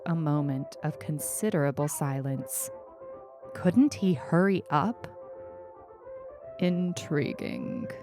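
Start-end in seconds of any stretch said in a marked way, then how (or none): none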